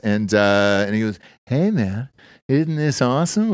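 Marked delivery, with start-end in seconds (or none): 1.4-3.5 laid-back financier. Retold or imitated. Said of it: "he talked like that".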